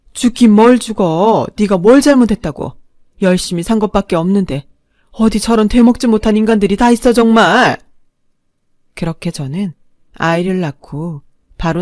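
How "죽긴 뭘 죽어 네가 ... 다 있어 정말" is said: aunt comforting niece, loud and indignant. Acted. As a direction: comfort her while cursing them, louder.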